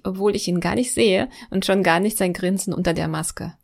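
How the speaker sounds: ordinary delivery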